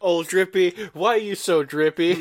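high, loud playful teasing; teasing laugh. Real, not acted. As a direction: tease him, close to laughing. Teasing friend about dripping sauce.

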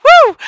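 very high, loud whoop; real whoop to end a laughing fit. Real, not acted. Recovering from the joke, loud high whoop.

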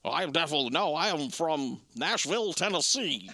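lightly put-on Southern American accent as Gerard Butler; a hint of an alien-sounding Tennessee accent; mock Gerard Butler accent. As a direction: imitate a Scotsman faking Nashville. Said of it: "that's what it sounds like to me".

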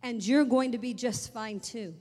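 loud, steady assurance; firm reassurance. Retold or imitated. Mother reassuring daughter over the phone, loud and sure. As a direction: declare it with certainty. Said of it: "And she said".

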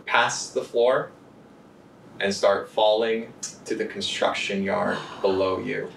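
slow, hushed suspense narration; dramatic narration. Acted. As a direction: draw out each beat for tension. GM narrates tense scene.

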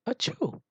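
high, small "achoo"; mock sneeze. A narrator's reading. Koel guesses at an elephant's sneeze, high mock sneeze. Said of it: "Maybe as loud as this".

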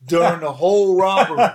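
real laughter while finishing a funny story; laughing. Real, not acted. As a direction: crack up at the punchline.

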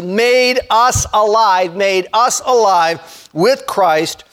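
stretched words, deliberate repetition; slow, emphatic. Real, not acted. Preacher rereads the verse to his congregation, slow and emphatic. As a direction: read it slowly so every word lands.

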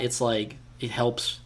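normal talking voice

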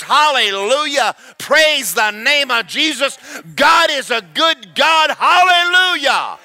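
high, loud cries of praise; exuberant worship shout. Acted. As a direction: shout it out with abandon, building and holding high. Re-enacts exuberant worship while driving.

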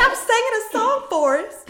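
high, loud mock shriek; indignant mock Jenny yelling at Forrest. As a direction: screech it at Forrest, hammed up. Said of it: "she's like".

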